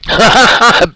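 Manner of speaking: sudden high-pitched laugh; real laugh bursting out; high burst of laughter. Real, not acted. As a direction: crack up at the chat. Bursts out laughing reading chat.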